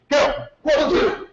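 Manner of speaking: high, sharp count of one two; drill count. Real, not acted. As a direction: bark the numbers like a drill sergeant. Martial arts drill shouting counts.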